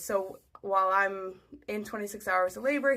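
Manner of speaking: normal talking voice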